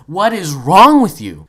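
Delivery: slow, loud acted exasperation; exasperated outburst. Gamer retelling his reply to a touchy player, loud exasperation. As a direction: punch it out in disbelief.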